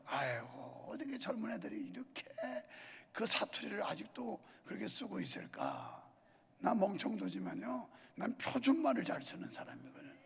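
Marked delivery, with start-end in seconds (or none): 0.0-6.0 soft, quick, half-crying sigh over it; a hammed-up wail of dismay, kept soft; mock lament. Mock lament about dialect. (반쯤 우는 듯한 과장된 한탄)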